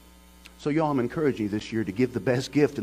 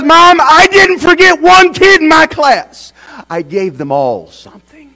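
slow, very high and loud joyful cry; excited shout; 23 dB louder, slower than usual. Retold or imitated. Boy proudly shouting to his mom, overjoyed. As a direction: shout it out proud and elated, drawn out.

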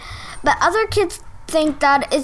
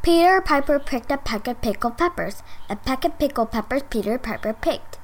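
a bit quicker, quieter run-through; medium fast; 5 dB quieter, faster than usual. Shown as a demonstration. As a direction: pick up the pace to medium. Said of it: "can you do that tongue twister any faster than that?".

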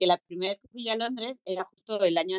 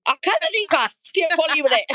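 slow, loud mock German accent; slow, louder, higher mock German accent; 6 semitones higher, 8 dB louder than usual. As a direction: drag the words out like a German tourist. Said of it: "Con su acento ahí medio alemán".

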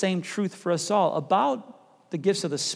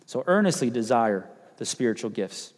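ordinary delivery

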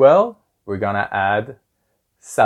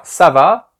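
"ça va" with va swung up high; question tune on ça va; 6 semitones higher, 7 dB louder than usual. Shown as a demonstration. Teacher explains the French question, "va" rising at the end. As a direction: ask "ça va" with a rising, louder va. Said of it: "you have to go up at the end".

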